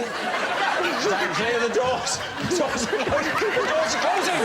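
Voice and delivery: train station announcement. Pretend. Repeated announcement, getting higher.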